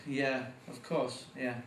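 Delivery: usual speaking voice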